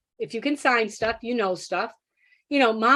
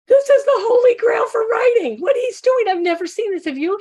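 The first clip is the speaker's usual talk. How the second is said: much higher and louder, gushing; bursting with amazement, nearly squealing; thrilled disbelief; 11 semitones higher, 6 dB louder than usual. Real, not acted. Teacher to her co-teacher, over the boy's writing, thrilled and much higher.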